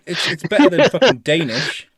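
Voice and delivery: ordinary talk, nothing marked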